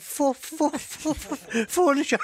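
high, loud stammering fu-, fu- into furniture. Retold or imitated. Stammering driver telling the copper what's in the back. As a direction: stutter up to the punchline word.

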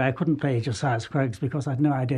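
usual speaking voice

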